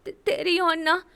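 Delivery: timid stammer. A narrator's reading. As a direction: get the word out nervously. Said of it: "என்றாள் ஒற்றை வார்த்தையில்".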